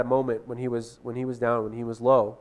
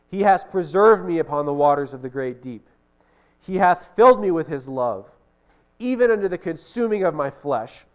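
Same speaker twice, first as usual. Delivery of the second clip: proclaiming scripture; 4 dB louder than usual. Acted. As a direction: lift the words up in triumph.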